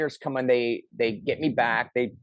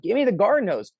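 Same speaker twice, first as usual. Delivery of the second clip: high, louder repeated demand; retold stubborn shout; 10 semitones higher, 4 dB louder than usual. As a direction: shout it back, refusing to budge. Insisting on the hose.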